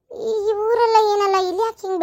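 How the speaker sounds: crying, high and slow; tearful. Acted. Wife torn about leaving her drunk husband, tearful and slow. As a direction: let the tears break through the words.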